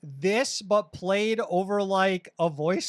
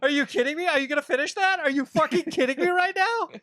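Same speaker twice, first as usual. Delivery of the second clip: mock outrage; 8 semitones higher, faster than usual.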